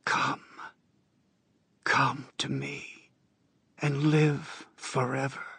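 high, slow, soft crone whisper; old woman whispering. Acted. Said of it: "an old woman's voice whispers".

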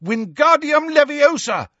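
high, urgent shouted spell. A narrator's reading. Harry casting at the attacking brains, shouting. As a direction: shout the incantation in desperation. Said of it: "he shouted".

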